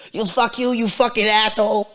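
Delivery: high-pitched mock yell of abuse; hammed-up road-rage holler; angry trucker imitation. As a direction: imitate a driver cursing someone out. Imitating an angry truck driver's insult.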